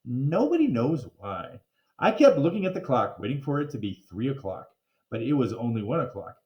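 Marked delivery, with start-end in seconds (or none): none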